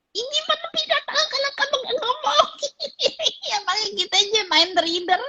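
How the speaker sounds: high, laughing through the words. Real, not acted. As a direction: laugh as you say it. Laughs at own point. (sambil tertawa)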